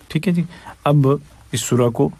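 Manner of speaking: ordinary delivery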